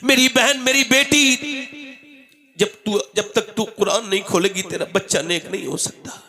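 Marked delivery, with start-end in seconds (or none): none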